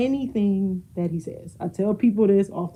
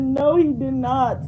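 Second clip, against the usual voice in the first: slow, crying with laughter; laughing hard; 5 semitones higher, 4 dB louder than usual. Real, not acted. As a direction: get it out between laughs. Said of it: "I was rolling".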